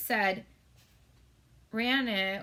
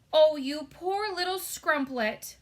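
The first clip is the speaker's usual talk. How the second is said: louder, slow pitying cry; 6 semitones higher, 7 dB louder, slower than usual. A narrator's reading. Giant crying out to Sophie in loud pity. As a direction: giant heartbroken for the girl. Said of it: "cried the B F G".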